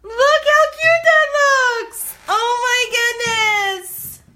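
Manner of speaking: loud, very high drawn-out squeal; ecstatic. Real, not acted. Crafter peeling off the stencil, ecstatic squeal. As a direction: shriek with delight, stretching the words. Freaking out at the reveal.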